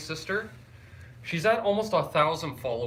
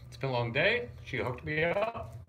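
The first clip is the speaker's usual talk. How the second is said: choked up; 3 dB quieter than usual. Real, not acted. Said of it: "I get a little choked up".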